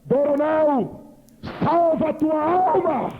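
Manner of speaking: booming slow voice from the sky; thunderous voice of God. Retold or imitated. Preacher voicing God calling from the sky, slow and booming. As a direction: proclaim it slowly like thunder from heaven. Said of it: "aquela voz dizia".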